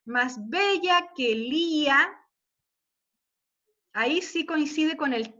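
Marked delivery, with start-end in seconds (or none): 0.0-2.2 "Más bella que Lía" said very slowly, leaning on "bella"; slow stressed scansion. Shown as a demonstration. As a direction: draw the line out and mark where the voice weighs. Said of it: "dónde se carga más la voz". (lento, cargando la voz en "bella")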